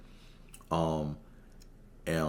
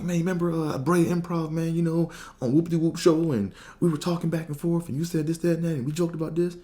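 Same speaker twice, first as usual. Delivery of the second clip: much higher, urgent reminder of their chat; eager pleading; 11 semitones higher than usual. Retold or imitated. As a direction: beg him to remember you. Pleading he remembers him.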